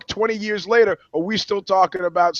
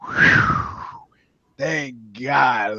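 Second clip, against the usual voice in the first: mock relief; 3 semitones lower, much slower than usual. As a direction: exhale hard, then hush. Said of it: "I go".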